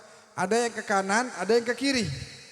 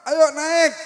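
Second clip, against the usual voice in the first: much higher and louder, slow shout: "Woi"; shouting up at night; 7 semitones higher, 9 dB louder, slower than usual. Retold or imitated. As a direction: yell down the well to come up. Betawi berteriak memanggil malam hari. (teriakan Betawi yang keras dan tinggi)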